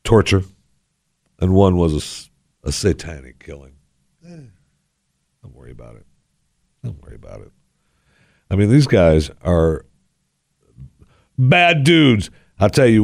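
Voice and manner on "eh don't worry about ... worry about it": very soft, sly murmur; mock reassurance. Host jokingly soothes listeners about gang murders, hushed. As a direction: hush it like a wink to the audience.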